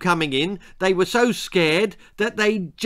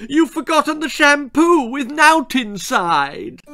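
high, loud wailed accusation; distraught, shrill-pitched outburst; wailing; 12 semitones higher, 7 dB louder than usual. A narrator's reading. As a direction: wail the complaint at full pitch. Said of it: "she wailed".

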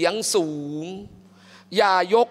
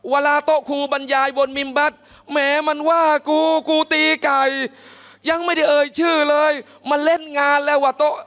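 high, loud, offended whining; touchy indignant complaint; 7 semitones higher, 3 dB louder than usual. Retold or imitated. Preacher mimicking a touchy worshipper offended by the sermon, indignant. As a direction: act as if the sermon was aimed at you.

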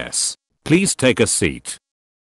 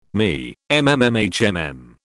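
usual speaking voice

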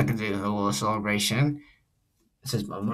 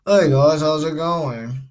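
slow, stretched-out greeting in a character voice; lightly put-on cartoon hero greeting; own character's voice; 3 semitones higher, 5 dB louder, slower than usual. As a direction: open loud on the hey, then drawn out. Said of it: "let's move on to my character".

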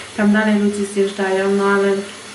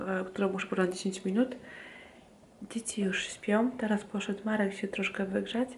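quiet at night; 11 dB quieter than usual. Real, not acted. Night, children asleep, soft goodbye.

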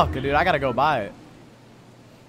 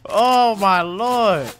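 exasperated groan; 7 semitones higher, 8 dB louder, much slower than usual. Real, not acted. Exasperated after fight.

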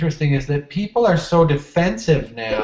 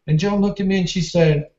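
ordinary talk, nothing marked

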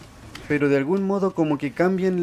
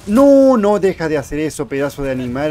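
alarmed yell; 2 semitones higher, 10 dB louder than usual. Real, not acted. Player tells the enemy to stop it, yelling. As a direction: yell in protest, easing as it goes.

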